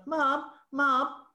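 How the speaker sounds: high-pitched imitated child's call; boy calling Mum. Little boy trying to get his busy mum's attention, calling out.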